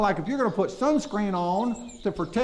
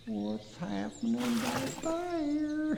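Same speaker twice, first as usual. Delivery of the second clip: hushed, slow and higher, glancing back; a soft, sneaky look over the shoulder; a quiet higher wondering, drawn out slowly; more subtle; 7 semitones higher, 8 dB quieter, much slower than usual. Shown as a demonstration. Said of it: "a little more subtle than that".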